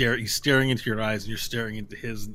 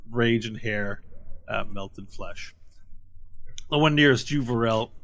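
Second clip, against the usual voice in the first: usual speaking voice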